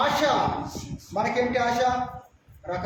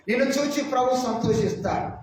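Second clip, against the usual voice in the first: ordinary talk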